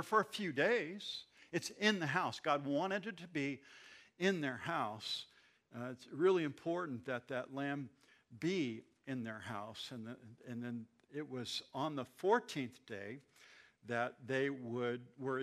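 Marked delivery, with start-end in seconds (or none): none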